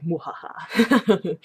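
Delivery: drawn-out villainous mwahaha; playful, slow evil cackle; mock evil laugh. Naming her Renfield's nightmare oil, mock sinister laugh.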